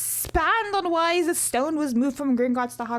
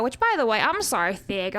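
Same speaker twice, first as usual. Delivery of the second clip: ordinary talk, nothing marked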